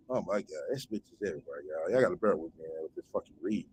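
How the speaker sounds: low, hushed, exasperated aside. Real, not acted. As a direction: mutter it low, half to yourself. Weary aside while reading a post.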